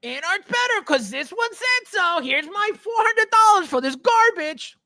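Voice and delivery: high, mocking voice of a gullible buyer; ridiculing a naive collector, high-pitched. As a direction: sneer it like a clueless fan handing over cash. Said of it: "And you go".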